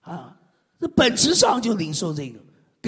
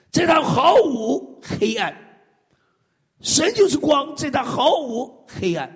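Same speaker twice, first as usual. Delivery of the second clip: preaching proclamation; 7 semitones higher, 3 dB louder, slower than usual. Real, not acted. Proclaims scripture verse.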